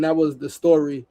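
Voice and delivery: ordinary talk, nothing marked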